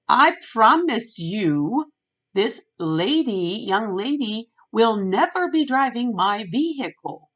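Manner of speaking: loud, drawn-out, word-by-word promise; emphatic retold vow. Customer promising the insurance agent about her vehicle, emphatic.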